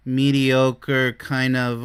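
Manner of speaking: very slow, sagging monotone; mediocre, downbeat. Acted. Imitating a partner on the mediocre side, slow downbeat drone. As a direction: drag it out like someone with no enthusiasm. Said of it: "they're just going down and down".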